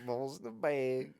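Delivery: high, slow comedian impression; slow, high-pitched catchphrase; Jim Gaffigan. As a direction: draw it out in a high silly voice. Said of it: "That's Jim Gaffigan".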